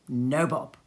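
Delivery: usual speaking voice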